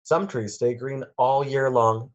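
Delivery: usual speaking voice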